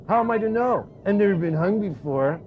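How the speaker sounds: high, innocent squawk; Punch puppet voice. Acted. Punch fooling the hangman, lightly high. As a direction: play dumb as Punch. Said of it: "Finally, Punch says".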